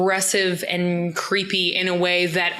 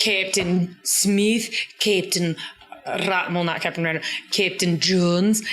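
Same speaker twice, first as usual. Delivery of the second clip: slow listing. Retold or imitated. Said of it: "she's like".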